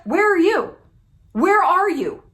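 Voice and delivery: loud, high "where are you?" called out; taunting call. Acted. Taunting imitators who will never measure up, loudly. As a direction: call out to the absent copycats. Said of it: "taunting copycats".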